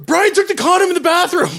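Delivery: high, loud imitation of a friend's alarm; acted-out urgent yell. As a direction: yell it like relaying an emergency.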